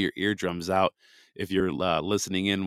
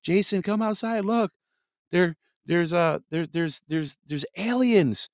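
high, shrill-free mock panic, much higher pitch; panicked neighbor; 11 semitones higher, 3 dB louder than usual. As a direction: play a neighbour freaking out over aliens. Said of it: "they're like".

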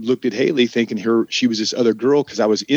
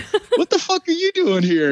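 surprised shout. Retold or imitated. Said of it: "I'm like".

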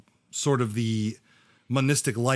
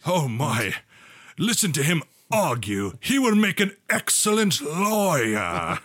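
stern father voice; 3 semitones higher than usual. Acted. Father voice admires arguing.

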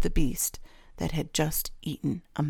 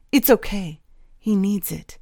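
plain reading voice

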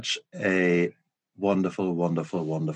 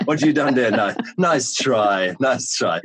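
teasing, amused put-down through chuckles; laughing mock challenge; 5 semitones higher, 5 dB louder than usual. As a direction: play a cheeky heckler, laughing. Acted laughing challenge from the audience.

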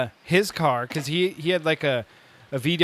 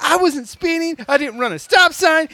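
loud, high-pitched indignant protest; frustrated; 12 semitones higher, 6 dB louder than usual. Retold or imitated. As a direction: act out an exasperated driver pleading innocence. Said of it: "He was so frustrated".

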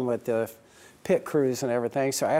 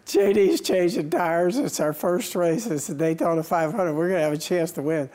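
nervous dread; 5 semitones higher than usual. Retold or imitated. Owner before the last pit stop, anxious and keyed up. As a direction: relive the pre-race nerves. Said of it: "looking for a different place to throw up".

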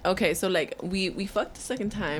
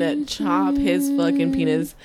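slow, higher, louder mock threat; 6 semitones higher, 5 dB louder, slower than usual. Joking to friends about an ex, slow mock threat. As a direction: drag out the threat for laughs.

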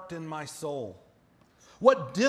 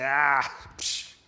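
put-on dismissive child; 6 semitones lower than usual. Acting out a defiant child.

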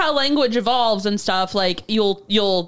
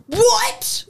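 retold surprise; 13 semitones higher than usual. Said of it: "I was like".

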